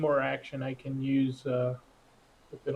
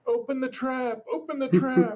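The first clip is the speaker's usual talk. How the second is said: high-pitched, urgent character yell; yelling for help; 11 semitones higher, 4 dB louder than usual. Acted. Trapped adventurer yelling to open the trap, high acted shout. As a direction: call out as if trapped down a pit. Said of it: "I'm yelling at the top of my lungs".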